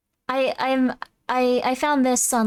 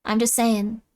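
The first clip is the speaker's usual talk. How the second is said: usual speaking voice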